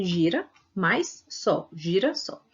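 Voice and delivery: "Gira, mais sol" spelled out slowly, part by part; slow teaching demo of "Gira" and "sol"; slow word parts. Shown as a demonstration. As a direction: lay out each piece of the compound slowly.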